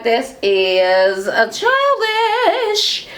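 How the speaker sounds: high, very drawn-out singsong; exaggerated dismay. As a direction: stretch the last word out high in mock disapproval. Teller's exaggerated reaction to name-calling.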